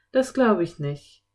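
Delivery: usual speaking voice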